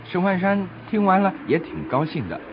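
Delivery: ordinary delivery, nothing marked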